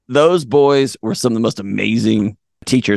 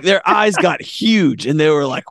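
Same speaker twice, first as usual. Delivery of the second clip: usual speaking voice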